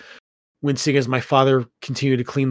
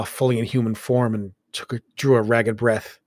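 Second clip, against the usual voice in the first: usual speaking voice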